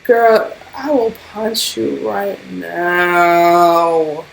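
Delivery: slow, deliberate threat; menacing slow drawl. Retold or imitated. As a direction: spell out each word as a warning. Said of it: "And I'm just like".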